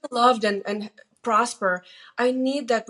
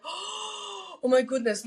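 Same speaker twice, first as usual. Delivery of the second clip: mock amazement; 8 semitones higher, 4 dB quieter, much slower than usual. Mock awe at simple phrase.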